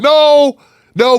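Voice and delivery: sharp, high, loud no. Real, not acted. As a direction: cut in loudly. Sudden objection.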